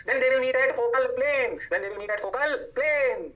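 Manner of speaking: drawn-out, chant-like recital; slow drilled recitation. Shown as a demonstration. Teacher drills the rule into students, slow recitation. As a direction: stretch each word very slowly.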